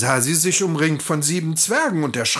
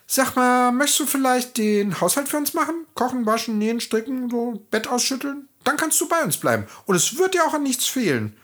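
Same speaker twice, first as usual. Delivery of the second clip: much higher, brisk, chatty little voice; friendly dwarf offer; 12 semitones higher, faster than usual. A narrator's reading. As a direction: persuade her cheerfully and quickly. Dwarfs offer her to stay.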